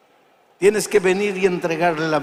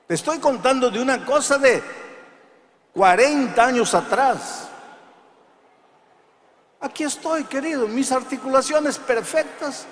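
preaching at full force, loud and high; predicación enérgica; 10 semitones higher, 5 dB louder than usual. Acted. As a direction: thunder it at the congregation. (predicador a plena voz)

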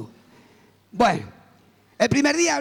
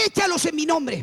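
higher, insistent divine command; voice of God; 5 semitones higher than usual. Retold or imitated. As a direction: give the order as an insistent voice from heaven. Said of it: "siento una voz que me dice".

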